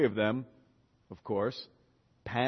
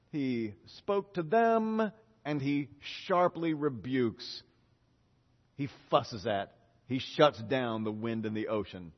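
normal talking voice

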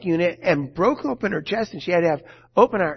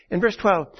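ordinary talk, nothing marked

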